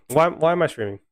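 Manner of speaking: fed-up "Why, why am I streaming?" with stress on why; exasperated whine. Real, not acted. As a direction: plead to the sky, hitting "why" both times. Fan venting at own team.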